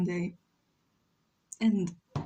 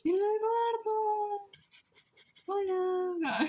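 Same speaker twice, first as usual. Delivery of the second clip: high sing-song greeting; 11 semitones higher, slower than usual. Acted. Greeting friend in chat.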